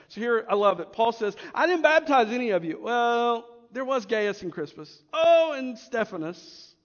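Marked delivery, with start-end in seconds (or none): 1.5-6.7 loud, comic self-correcting protest; playful retold outburst, backtracking; mock rant. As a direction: insist loudly, then remember exceptions one by one. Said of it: "kind of goes on a rant".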